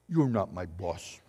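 defiant quote. Retold or imitated. Defiant person talking back.

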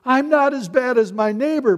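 high, loud mock excuse; hammed-up loud self-defence; self-justifying protest. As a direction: blurt it out in indignant parody. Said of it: "we always want to justify ourselves".

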